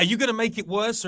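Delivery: ordinary delivery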